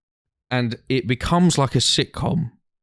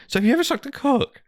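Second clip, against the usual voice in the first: high-pitched mock questioning voice; teasing question. Friends quizzing a man at a party, high mock voice.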